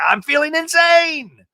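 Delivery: high, loud, slow shriek; hammed-up meltdown; mock frantic. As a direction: wail it like you're losing it. Shannon mocked.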